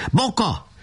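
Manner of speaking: high call from far away. A narrator's reading. Nemecsek calls to Boka from afar, high call. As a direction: call the name across the lot. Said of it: "már messziről kiáltott".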